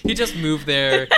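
laughing through the words. Real, not acted. Joking while laughing.

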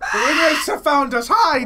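high, loud mock-terrified cry; play-acted alarm, loud and high; mock panic. Mock panic after shrill noise.

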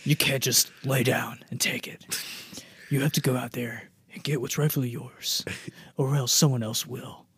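hushed, urgent encouragement; whispered pep talk. Retold or imitated. Yogi urges Ranger Smith, whispered. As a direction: whisper the speech like a secret.

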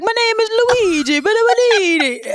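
high, hammed-up Italian accent; Jared Leto. Friend mocking a film's bad Italian accent, cartoonish high Italian. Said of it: "Jared Leto's Italian accent".

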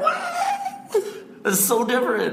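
high, stunned, stretching the so; real shock at learning the news; sky-high astonished exclamation; shocked disbelief. Real, not acted. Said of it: "Nick is freaking out".

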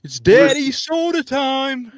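high, slow hype-man call; drawn-out announcer catchphrase. Pretend. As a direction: boom it out like a show intro.

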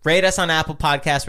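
upbeat, high, fast host sign-off; podcast outro. Acted. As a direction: hype up the outro like a radio host.

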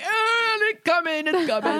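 high wailing mock alarm; mock panic. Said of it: "and then I was just like".

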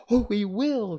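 high, loud eager mice answering; shrill chorus. Acted. Mice promising their queen, high loud chorus. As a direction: chirp it together eagerly. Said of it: "in a shrill chorus".

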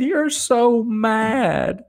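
slow, drawn-out high tease; wife teasing. Retold or imitated. Wife teasing the enraged husband, drawn-out and high. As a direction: stretch it out teasingly. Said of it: "She said".

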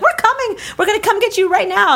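high-pitched, urgent mock mother; frantic mom. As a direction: rush to the rescue in a high voice. Acting alarmed mother on phone.

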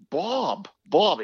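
high, loud Boston accent impression; Ken Beatrice Boston accent. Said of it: "he had this thick Boston accent".

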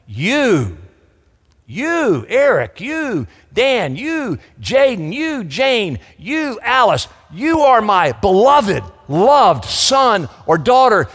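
high, loud proclamation of each name; the Father calling. Acted. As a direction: call out each name like God from heaven. God's voice calling believers by name.

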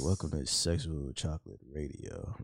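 low, slow late-night radio host; sensual radio voice. Acted. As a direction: croon it low like a smooth DJ. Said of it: "this man sounds sensual as fuck".

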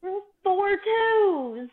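drawn-out high cry at the dice; excited cry. Real, not acted. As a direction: wail it out over the bad roll. Dice roll cheer.

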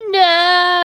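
high, loud "No!" like a stabbed villain; hammed-up scream. Reading the fanfic villain's cry after the stabbing, hammed-up scream. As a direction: belt out a cartoonish death cry. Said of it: "he screamed".

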